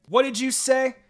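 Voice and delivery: sharp, loud and high challenge; angry scowl. Acted. Rival girl angrily confronting the narrator. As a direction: snap it back at her, offended.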